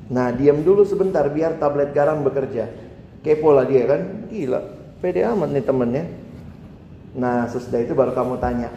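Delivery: normal talking voice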